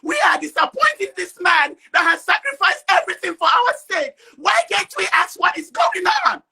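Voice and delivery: real anger, demanding answers; upset shouting. Real, not acted. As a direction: shout it out, too upset to hold back. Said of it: "I know I'm upset".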